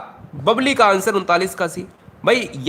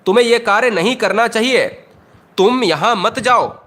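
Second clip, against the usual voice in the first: emphatic, higher-pitched command; आदेश देते हुए; 5 semitones higher, 4 dB louder than usual. Shown as a demonstration. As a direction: lay down the order firmly, word by word. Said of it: "हम order दे रहे हैं". (जोर देकर, ऊँचे स्वर में आदेश)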